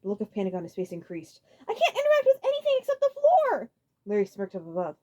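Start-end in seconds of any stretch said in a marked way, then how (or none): 1.7-3.7 high, loud panicking; frantic shout; panicked. A narrator's reading. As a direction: cry out in alarm. Panic increased.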